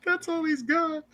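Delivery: helpless laughter. Real, not acted. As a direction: barely get it out while cracking up. Laughing at friend's one voice.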